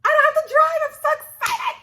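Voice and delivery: squeaky high, slow, laughing joy; giddy relief. Retold or imitated. Relieved daughter squealing about not having to drive. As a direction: squeal it like a kid let off the hook. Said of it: "I'm low-key relieved".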